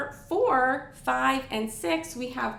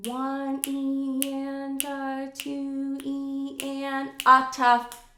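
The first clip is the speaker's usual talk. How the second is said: very slow count intoned on a level pitch; really slowed down; much slower than usual. Shown as a demonstration. Teacher slowing the sixteenth count right down, drawn-out chant. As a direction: stretch every syllable of "one E and a" evenly. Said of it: "if you slowed that really down".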